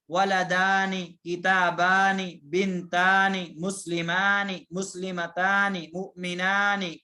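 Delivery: ولدانی drawn out slowly, the آنی ending held long; stretched dual endings. Shown as a demonstration. Teacher slowly spells out the dual ending آنی for students. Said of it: "آنے کی آواز آئے گی".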